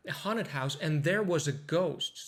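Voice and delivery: "haunted" unstressed with its T dropped; slow line, no T in "haunted", focus on "ghost"; skipped T. Shown as a demonstration. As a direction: slide through "haunted" like "haunnid", land on "ghost". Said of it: "skip over that T".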